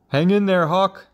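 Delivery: high, loud encouragement; urging father frog. A narrator's reading. Father frog to the tiring hawk, loud high urging. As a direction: call up loudly to keep it going.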